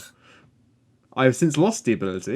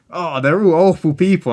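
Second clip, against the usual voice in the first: raised, louder mock whine of disapproval; higher, louder mimicked outrage; mock complaint; 5 semitones higher, 5 dB louder than usual. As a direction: imitate a co-host's exaggerated complaint. Mimicking co-host's past complaint.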